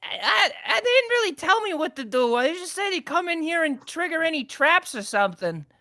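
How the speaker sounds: high-pitched, stammering goblin excuse; flustered goblin underling. Acted. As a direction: whine out your excuse as a goblin. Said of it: "and he's like".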